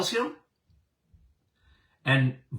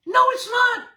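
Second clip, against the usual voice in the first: "No" shouted much higher and louder; shouted denial; 4 dB louder than usual. Real, not acted. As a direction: blast the denial out. Rebuts the critics, then repeats.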